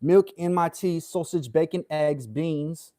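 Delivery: mock British accent, pitched a little up; hammed-up British accent reciting a list. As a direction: put on a chirpy British accent. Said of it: "does a better British accent".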